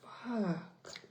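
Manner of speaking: retelling her own stunned reaction, slow and soft; stunned disbelief. Retold or imitated. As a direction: let it drop out in quiet disbelief. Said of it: "I'm like".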